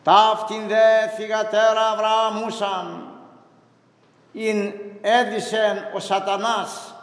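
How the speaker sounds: slow, measured liturgical intoning of scripture; Gospel reading. Acted. Priest reading the Gospel to the congregation, slow liturgical intoning. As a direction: intone the Gospel slowly, as at the altar.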